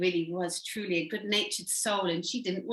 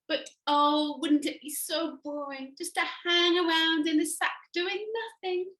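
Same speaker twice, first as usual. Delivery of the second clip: horrified caterpillar; 6 semitones higher than usual. Acted.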